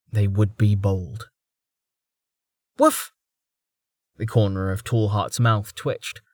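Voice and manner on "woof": quick high-pitched woof; dog bark. A narrator's reading. Dog wanting more scratching, high woof.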